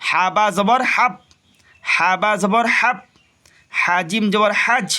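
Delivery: teacher chanting the letter drill; spelling drill. Shown as a demonstration. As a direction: sound out each syllable for students. Quran reading teacher drills letters.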